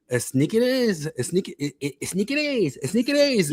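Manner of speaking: high, struggling Mexican-accented attempts; exaggerated high Mexican Spanish accent. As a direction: butcher "Snickers" as es-NEE-keh-dehs, Mexican accent. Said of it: "they butchered the American word".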